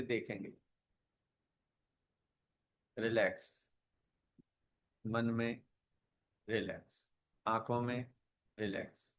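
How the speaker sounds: soft, unhurried meditation voice with long pauses; guided meditation. Real, not acted. Yoga teacher guiding students to watch the breath, calm meditation voice. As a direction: guide them gently into relaxation, phrase by phrase.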